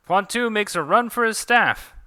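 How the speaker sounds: high, loud play-by-play call; excited sportscaster voice; match announcer. Acted. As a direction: call the action like a ringside commentator. Calls the duel as commentator.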